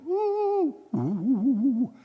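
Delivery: high, drawn-out hooting like an owl at night; owl hoots. Retold or imitated. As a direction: hoot slowly like an owl. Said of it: "I mean, owls and all that".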